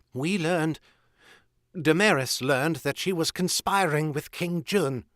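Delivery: high, pleading confession; nearly weeping. A narrator's reading. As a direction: confess on the verge of tears. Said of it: "nearly weeping now".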